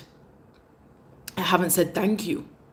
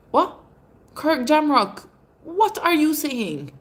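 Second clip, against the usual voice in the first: baffled; 9 semitones higher, 3 dB louder, slower than usual. Real, not acted. Baffled by a comment.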